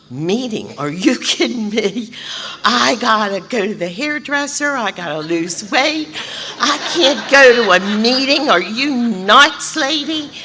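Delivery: high, loud, disbelieving refusal with a laugh; are you kidding me, slowing on nuts; incredulous outburst. Retold or imitated. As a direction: balk at the idea, laughing in disbelief. Retold outraged refusal.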